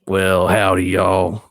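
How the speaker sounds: light Texas drawl on howdy and y'all; a folksy Texas greeting, lightly done; Texas accent. Shown as a demonstration. Player shows the host her Texas accent on request. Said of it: "I get to do my Texas accent".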